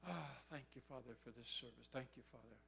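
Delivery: soft, low sigh of a prayer; half-hearted prayer. Retold or imitated. Man alone at the back trying to pray, half-hearted and soft. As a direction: murmur a dutiful prayer, low and tired. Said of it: "quote, "trying to pray".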